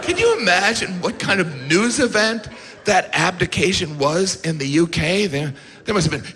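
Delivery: ordinary talk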